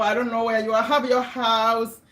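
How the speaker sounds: ordinary talk, nothing marked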